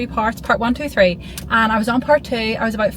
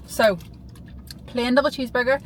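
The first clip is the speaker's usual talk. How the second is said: normal talking voice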